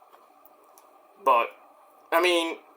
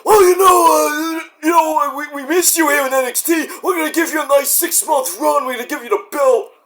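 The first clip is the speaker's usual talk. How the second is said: hammed-up, shouted, higher voice; Shawn Michaels impression; 6 semitones higher than usual. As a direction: sell the promise like an overexcited boss. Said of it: "he's like".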